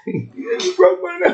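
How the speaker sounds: high, drawn-out film quote; mock wail. Quoting the loser of the movie's last fight, mock wail. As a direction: imitate a hurt kid's slow, high complaint.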